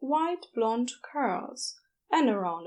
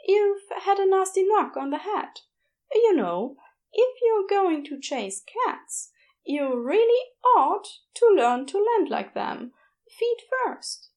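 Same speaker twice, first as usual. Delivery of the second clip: higher, louder, gentle bedside voice; kindly nurse; 8 semitones higher, 4 dB louder than usual. A narrator's reading. Nurse Aziraphale greeting injured Crowley, cheerful and soothing.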